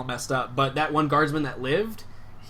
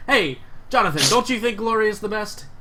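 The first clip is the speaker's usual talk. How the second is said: higher, eager call to his comrade; 7 semitones higher, 3 dB louder than usual. Acted. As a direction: pull the comrade in with gusto. Guard calls out to Jonathan.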